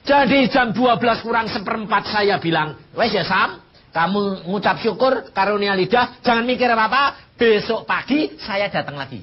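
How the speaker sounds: high, loud preaching climax; fervent preaching. Real, not acted. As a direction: drive it home to the congregation. Preaching climax, quote to Sam.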